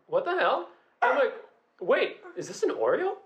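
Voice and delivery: high-pitched incredulous quote; mock bewilderment. Storyteller replaying a second cookie surprise, mock bewilderment. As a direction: squeak out retold disbelief.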